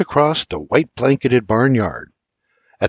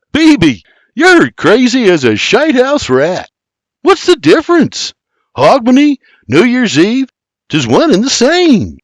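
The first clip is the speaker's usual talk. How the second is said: exasperated, much higher retort; 12 semitones higher, 5 dB louder than usual. Acted. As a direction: fire back, unflinching. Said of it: "William retorts".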